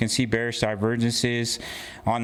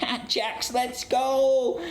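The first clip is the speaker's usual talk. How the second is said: high, eager hype-man voice; mock fan voice; 12 semitones higher, much slower than usual. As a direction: cheer like a bandwagon fan. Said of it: "now they're all like".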